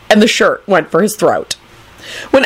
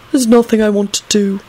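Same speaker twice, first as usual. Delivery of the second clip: yawned and lazy; yawning; 3 semitones higher than usual. Acted. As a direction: say it through a yawn. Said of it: "Clive yawned".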